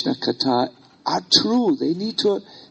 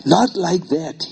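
firm retold answer; 3 semitones higher, 5 dB louder, much slower than usual. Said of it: "He said".

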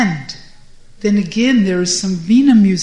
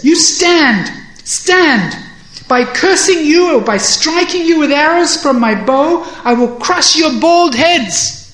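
high, loud blustering challenge; defiant threat; 7 semitones higher, 5 dB louder than usual. A narrator's reading. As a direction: threaten the enemy with raised, forceful voice. Acted threat to crush the demons.

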